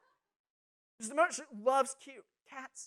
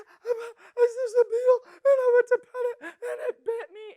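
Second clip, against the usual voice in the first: put-on very high, loud sobbing child; imitated bawling; 13 semitones higher, 13 dB louder than usual. Little sister explaining the bite to her family, mock sobbing. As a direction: wail it through exaggerated tears. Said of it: "just crying, sobbing, little words just jumbling together".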